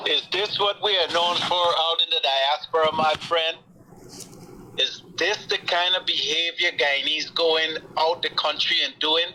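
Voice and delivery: usual speaking voice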